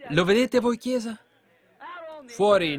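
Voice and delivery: high, loud demand; shouted challenge. Real, not acted. Preacher challenging the congregation, loud and high. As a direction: call out to the church.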